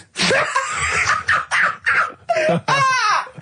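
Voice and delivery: long high peal of real laughter. Real, not acted. Host laughing at the strangest couple story. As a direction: crack up helplessly.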